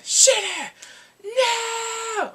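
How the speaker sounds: very high, loud cry of dismay; hammed-up shriek of disbelief; horrified shout. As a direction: wail it out like an appalled viewer. Horrified at who comes in.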